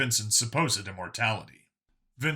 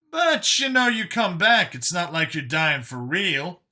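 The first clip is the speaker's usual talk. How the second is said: protesting Brian; 6 semitones higher, 4 dB louder than usual. Acted. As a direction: start high and forceful, then settle. Voices Brian in the story.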